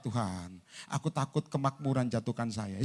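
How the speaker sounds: low, soft imitation of a timid believer; mock pious prayer. Believer afraid of prosperity praying, mock-meek and hushed. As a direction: pray meekly, sinking in pitch and volume.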